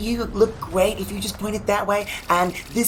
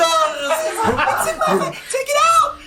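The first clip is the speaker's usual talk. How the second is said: much higher, screaming panic; panicked scream; 12 semitones higher, slower than usual. Acted. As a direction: scream to get it off. Melted plastic in the face.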